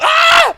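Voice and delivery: very high, loud shriek; mock shocked scream. Storyteller voicing the other man's imagined reaction, mock shocked scream. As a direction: shriek as the startled partner.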